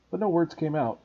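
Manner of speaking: usual speaking voice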